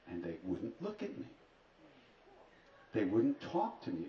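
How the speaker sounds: usual speaking voice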